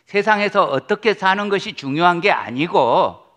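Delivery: fervent pulpit blessing; preaching at full force. Acted. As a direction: proclaim it to the congregation with fervour.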